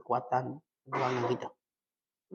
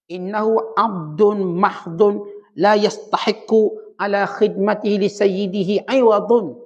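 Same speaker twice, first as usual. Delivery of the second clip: recited Arabic; 4 semitones higher, 5 dB louder, much slower than usual. Acted. Arabic quotation recited in sermon.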